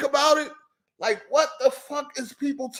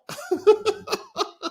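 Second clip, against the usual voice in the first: high laugh; 11 semitones higher than usual. Real, not acted. Laughing at chat name.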